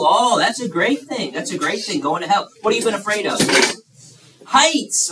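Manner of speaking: usual speaking voice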